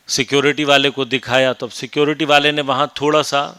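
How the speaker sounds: ordinary delivery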